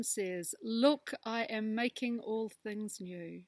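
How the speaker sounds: slow, hushed prayer voice; prayer reading. Acted. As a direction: read it like a gentle prayer. Reads closing prayer aloud.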